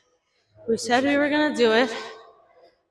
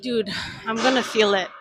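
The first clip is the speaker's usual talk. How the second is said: ordinary talk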